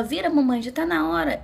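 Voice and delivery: high, loud imitation of a brisk order; urging obstetrician. Obstetrician urging the mother to turn.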